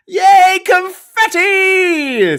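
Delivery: high, slow squealed cheer; quoting a viewer's yay, stretched out; reading out excited cheer. Retold or imitated. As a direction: shriek it like a kid at a party. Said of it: "says Diz".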